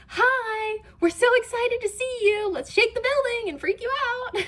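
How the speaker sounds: high, loud, over-excited greeting; gushing island welcome. Acted. Island welcoming the jets to Japan, mock-gleeful. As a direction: put on a squealy, hammed-up cheerful host voice.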